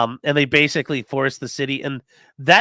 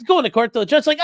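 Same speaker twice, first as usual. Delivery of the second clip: ordinary talk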